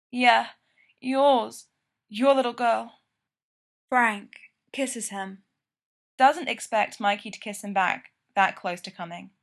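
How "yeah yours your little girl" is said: keening. A narrator's reading. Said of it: "Mikey's nearly keening when he says".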